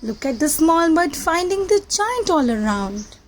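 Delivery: plain reading voice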